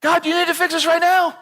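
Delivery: high pitched impatient demand; mock demanding panic. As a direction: whine at God to fix it now. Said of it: "And we freak out".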